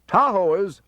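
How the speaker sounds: high, loud alarm call; crying out an alarm over a crowd. A narrator's reading. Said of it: "I called".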